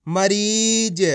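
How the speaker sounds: मरीज with the ee of री held long; drawn-out reading. Shown as a demonstration. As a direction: stretch the middle of मरीज for learners. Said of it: "खींच खींच के पढ़ना है".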